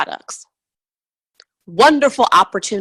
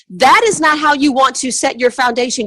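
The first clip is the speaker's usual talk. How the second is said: ordinary talk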